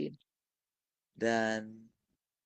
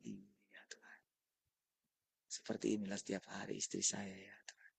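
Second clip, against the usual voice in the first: hushed, voiceless plea to God; whispered prayer; 9 dB quieter than usual. Retold or imitated. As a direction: whisper it as an inner prayer, much softer. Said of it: "Situ saya membayangkan".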